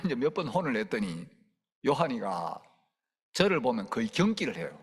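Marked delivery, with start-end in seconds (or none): none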